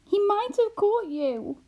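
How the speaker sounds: high, slow, shuddering dread; stifled shudder. A narrator's reading. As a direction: choke the words back in fear. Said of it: "shuddered Homily in a stifled voice".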